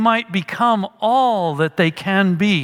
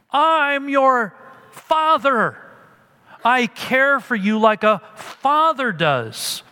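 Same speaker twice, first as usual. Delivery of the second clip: slow, higher-pitched fatherly plea; plaintive; 7 semitones higher, slower than usual. Acted. Paul pleading with Corinth as their father, plaintive. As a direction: draw it out as a mild plaintive appeal. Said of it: "he says it here with such plaintive words".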